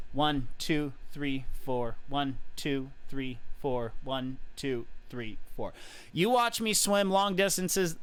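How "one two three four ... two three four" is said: even one two three four, softer as it goes; counting a cadence. Shown as a demonstration. Coach demonstrating his kick cadence, steady counting. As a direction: count out the beat like a metronome. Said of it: "my personal cadence is".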